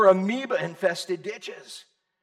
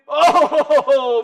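loud laugh; 6 semitones higher, 11 dB louder than usual. Real, not acted. Laughs at listener's reaction.

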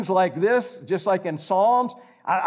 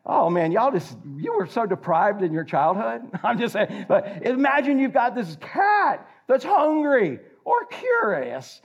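normal talking voice

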